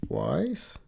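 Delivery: high, rising one-word query; surprised question. Real, not acted. Co-host checking whether he beat him twice, surprised question. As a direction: pitch jumps up in disbelief.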